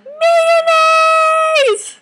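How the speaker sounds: very high, loud squealed yell; silly high-pitched shout; joke yell. Retold or imitated. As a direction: shriek the inside joke across the field. Inside-joke cry in softball story.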